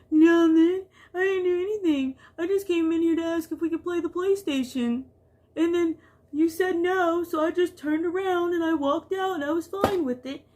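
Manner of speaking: innocent whiny denial. Retold or imitated. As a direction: play the wronged angel. Daughter's innocent denial voiced.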